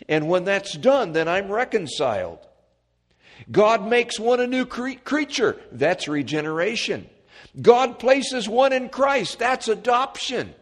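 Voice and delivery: high, loud preacher's cadence; full-force preaching. Acted. Preacher proclaiming redemption to the congregation, full-force preaching. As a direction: proclaim it to the whole church.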